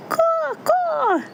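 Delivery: very high, drawn-out bird call; piercingly high crow cry; crow caw. A narrator's reading. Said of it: "said the crow".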